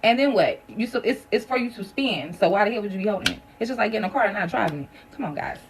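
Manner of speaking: usual speaking voice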